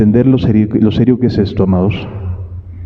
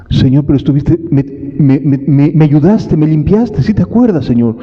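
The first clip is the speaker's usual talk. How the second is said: pleading believer; 7 semitones higher than usual. Retold or imitated. Latecomer pleading with the Lord.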